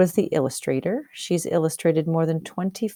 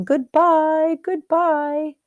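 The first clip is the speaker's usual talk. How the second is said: high, lightly voiced proud chick calling goodbye; proud farewell; 11 semitones higher, 4 dB louder, much slower than usual. Half Chicken announcing his farewells to the ranch, high and proud. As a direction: sing out goodbye like a vain little chick.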